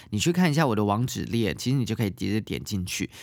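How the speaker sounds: ordinary delivery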